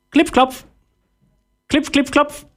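high, crisp knocking syllables; very high-pitched hammer noise; imitated tiny hammering. A narrator's reading. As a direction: tap out each word high, like a little hammer. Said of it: "wie ein Hämmern".

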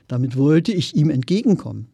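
usual speaking voice

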